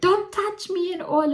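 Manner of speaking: put-on high child's cry of refusal; upset child. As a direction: squeal it like a little girl pushing her away. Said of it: "She's like".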